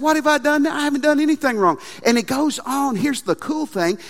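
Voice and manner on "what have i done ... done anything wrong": higher, hurt innocence; indignant protest. Acted. David answering his brothers, indignant and higher. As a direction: insist you did nothing wrong. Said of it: "David said".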